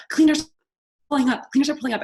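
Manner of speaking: loud, high alarm at the cleaners arriving; panicked shout. Retold or imitated. Mom shouting to her kids that the cleaners are pulling up, panicked. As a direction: sound the alarm, frantic.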